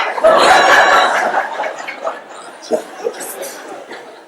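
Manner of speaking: very high, loud fit of laughter; roaring laugh. Real, not acted. Teller laughs hard at the friend's answer, high and loud. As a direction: collapse into laughter.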